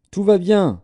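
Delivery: high, loud cry of good news; cried out. A narrator's reading. Runner shouting good news to the king, loud high cry. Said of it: "Akimaats cria".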